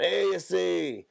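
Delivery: put-on high, drawn-out Mexican accent; exaggerated Mexican-accented warning. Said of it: "They always sound".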